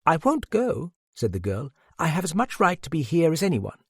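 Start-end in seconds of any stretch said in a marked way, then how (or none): 0.0-0.9 put-on high girlish refusal; high-pitched, stubborn girl; defiant girl. A narrator's reading. Narrator voices the girl refusing. 2.0-3.8 put-on high, quick girlish insistence; high-pitched, stubborn girl; defiant girl. A narrator's reading. Narrator voices the girl insisting.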